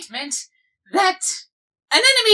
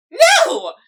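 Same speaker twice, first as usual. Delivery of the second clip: horrified no; 8 semitones higher, 3 dB louder than usual. Acted. Horrified at the couple.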